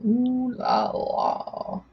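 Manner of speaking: long, soft, drawn-out ooh la la; drawn-out exclamation. Pretend. Friend reacts to a French story, drawn-out exclamation. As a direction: stretch it out slowly and softly.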